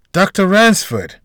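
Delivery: drawn-out recognition of the doctor; sigh after surprise. A narrator's reading. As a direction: let the name out slowly, caught by surprise. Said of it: "letting out a sigh".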